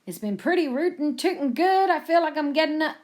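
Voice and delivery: hammed-up American cowboy; best cowboy voice. A narrator's reading. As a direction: play the cowboy with a Wild West twang. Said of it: "in his best cowboy voice".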